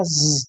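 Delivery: ज़ as a voiced buzz, no vowel; buzzing Z sound. Shown as a demonstration. Teacher asking students to remember Z, buzzing ज़. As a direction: hum the ज़ on its own, like "zzz". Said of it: "ज़ेड का sound होता है".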